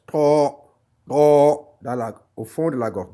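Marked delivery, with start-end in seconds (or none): 0.1-1.8 "To" said louder, from the back of the throat; deep-throat t. Shown as a demonstration. Teacher drilling students on the throaty "to", said loud and deep. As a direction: hit "to" hard and far back in the throat, louder each time. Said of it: "disons un T au fond de la gorge".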